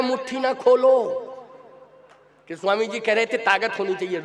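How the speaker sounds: ordinary talk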